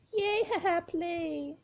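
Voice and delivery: put-on high, giggling childlike spirit; giggling ghost girl. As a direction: laugh like a playful eerie child. Jane laughing dancing.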